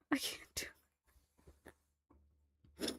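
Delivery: breathless scared whisper, barely voiced; frightened whisper. Real, not acted. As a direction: hush it like you can't bear to watch. Listener overwhelmed by the scene.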